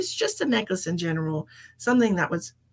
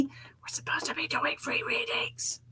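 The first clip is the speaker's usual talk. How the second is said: whisper; 5 semitones higher, 8 dB quieter than usual. Real, not acted. Aside to Jesse off-mic.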